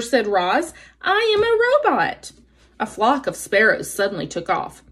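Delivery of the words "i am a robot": high, level robot voice; storybook robot, pitched well up. A narrator's reading. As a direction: speak as a robot on one held high pitch. Robot character introduces itself in story.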